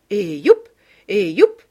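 loud festive yelps; calling out. A narrator's reading. Mother whoops as she bursts into the dance, loud.

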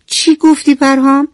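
mother can't believe her hungry son refuses lunch; surprised mother. Acted. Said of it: "از شنیدن این حرف تعجب کرد و پرسید". (با تعجب و صدای بلندتر)

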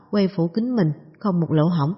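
ordinary delivery, nothing marked

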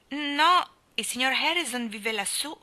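a high, loud startled no, then settling; bewildered. A narrator's reading. Anna, startled by the stranger's question, answers bewildered. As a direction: caught off guard, blurting it out. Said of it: "rispose Anna del tutto sconcertata".

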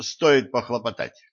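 plain reading voice

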